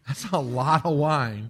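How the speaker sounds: laughing through the words. Real, not acted. Preacher amused by Calvin's wine salary, laughing. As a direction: chuckle at the amount of wine.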